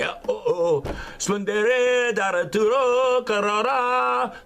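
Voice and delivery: high, slow mock ecstatic chant; mock tongues. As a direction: ham up speaking in tongues. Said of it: "so that they can say".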